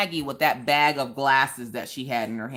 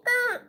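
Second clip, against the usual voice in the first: bus groan. Retold or imitated. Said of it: "you see the whole bus just go".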